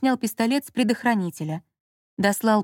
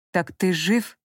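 through gritted teeth; slower than usual. Acted. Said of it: "прошипел он сквозь сжатые зубы".

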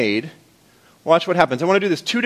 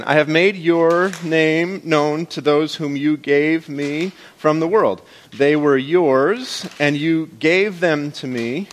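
reading slowly; slower than usual. Shown as a demonstration. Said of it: "I'll read it slow so you can get your claps in".

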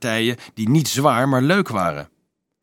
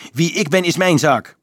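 curt angry snap, pitched up; snapping; 8 semitones higher, 5 dB louder than usual. A narrator's reading. Boy refusing to answer the priest, sharp snap. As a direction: bite the words off. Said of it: "snauwde Dolf".

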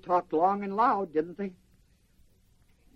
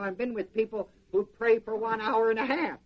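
usual speaking voice

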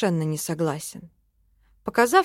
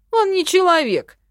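high, loud indignant protest; sulky ferret objecting at full pitch; huffy snort; 11 semitones higher, 8 dB louder than usual. A narrator's reading. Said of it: "фыркнул хранитель, насупившись".

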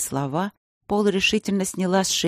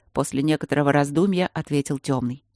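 ordinary delivery, nothing marked

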